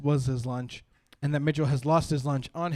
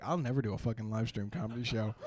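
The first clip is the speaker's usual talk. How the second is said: quiet grumble; 6 semitones lower, 10 dB quieter than usual. Retold or imitated. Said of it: "I was like".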